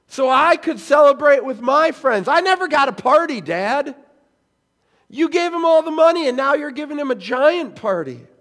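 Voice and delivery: loud, high furious complaint at his father; rage. Retold or imitated. Older son yelling at father over the party. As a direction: shout the unfairness, voice rising. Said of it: "You can hear the rage".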